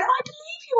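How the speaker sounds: high, urgent assurance; pleading cry. A narrator's reading. Girl reassures offended giant, high pleading cry. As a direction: cry it out to win him back. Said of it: "Sophie cried".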